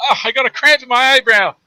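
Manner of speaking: husband complaining to wife, whiny; whiny complaint. Retold or imitated. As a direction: whimper it out like a wounded man. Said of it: "I went".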